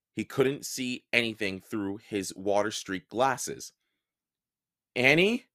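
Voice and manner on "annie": ordinary delivery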